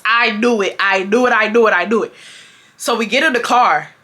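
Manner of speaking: normal talking voice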